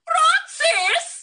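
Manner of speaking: calling out. Acted. Calling the drawer from offstage.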